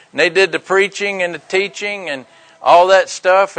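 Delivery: ordinary talk, nothing marked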